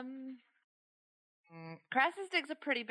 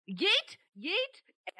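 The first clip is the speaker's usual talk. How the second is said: gleeful yeet; 11 semitones higher, 5 dB louder than usual. Real, not acted. Celebrating the attack.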